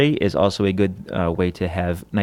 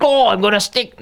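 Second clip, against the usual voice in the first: high, hammed-up Aussie caricature; cartoonish Australian accent, pitched way up; South Park Australian parody; 13 semitones higher, 4 dB louder than usual. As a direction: spoof an excitable Aussie adventurer. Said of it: "do the South Park thing".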